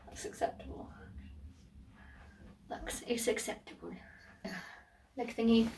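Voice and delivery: soft unvoiced whisper. Real, not acted. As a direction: breathe it as a secret aside. Whispered mirror verdict.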